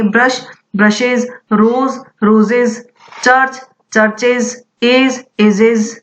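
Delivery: ब्रशेज रोजेज चर्चेज एजेज each end in iz; iz plural ending. Shown as a demonstration. Teacher drills plurals for the class, iz endings clear. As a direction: model every plural with an iz ending. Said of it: "इस तरह से इसका pronunciation करेंगे".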